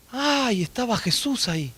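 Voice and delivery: soft, taken-aback aside; sobresalto apocado. Acted. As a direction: drop your voice as if caught off guard. Woman startled to find Jesus inside. (sobresalto en voz baja)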